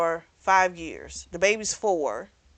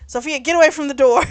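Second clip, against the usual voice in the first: fast, louder and higher, a sharp command; urgent warning; 6 semitones higher, 8 dB louder, much faster than usual. Retold or imitated. Mother warning her daughter away from the door, urgent and loud. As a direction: hurry the child away from the door. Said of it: "she tells Sophia".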